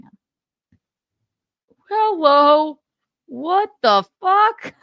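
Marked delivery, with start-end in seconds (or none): 1.9-4.7 high, loud, drawn-out disbelief; mock bewilderment. Host voicing winners' reaction to the intruder, mock bewilderment. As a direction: stretch it out in hammed-up shock.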